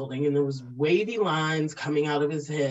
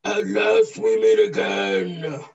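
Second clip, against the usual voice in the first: slow, booming villain line; booming echoing villain monologue; 5 dB louder, slower than usual. Shown as a demonstration. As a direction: give it everything, a big bad supervillain. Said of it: "give it all you got".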